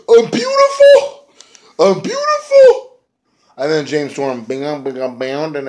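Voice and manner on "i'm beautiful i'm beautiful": very high, loud and slow; hammed-up falsetto cry of vanity; shrill mock scream. Abyss screams in face paint.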